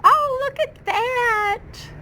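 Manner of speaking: high, slow cooing voice. Real, not acted. Gardener coos at baby succulents, high baby talk.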